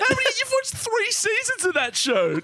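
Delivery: high, squealing incredulous laughter; real amused astonishment, voice shooting up; laughing disbelief. Real, not acted. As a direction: tease through uncontrolled laughing. Mocking friend's mistake, laughing.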